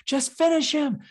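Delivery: high, loud urging shout; mock crowd yell. Fans urging Goku in a retold fight, high mock shout. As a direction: shout it like the crowd wants the kill. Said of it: "everyone was like".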